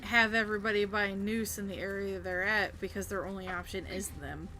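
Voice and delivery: ordinary talk, nothing marked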